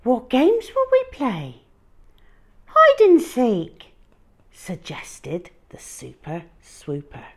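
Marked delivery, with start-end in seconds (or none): 0.0-3.9 high, loud playful dialogue; voiced story characters. Boy and dinosaur planning a game, high and playful. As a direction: bounce the lines between characters.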